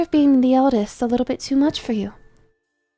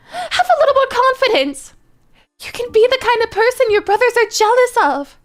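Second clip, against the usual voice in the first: high, urgent motherly pleading; frantic, high-pitched pep talk; agitated insistence; 9 semitones higher than usual. Acted. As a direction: push encouragement on him, pitched right up. Mother urgently pushing her upset son.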